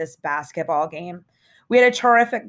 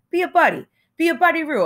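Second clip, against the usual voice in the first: pleading; 11 semitones higher, faster than usual. A narrator's reading. Stradlater begging a favor.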